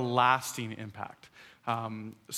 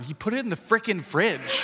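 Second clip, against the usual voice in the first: put-on higher little-kid tattling; small child; 5 semitones higher than usual. Three-year-old reporting dad to mom, high childish complaint. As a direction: imitate a child innocently repeating dad's words. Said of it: "He's like".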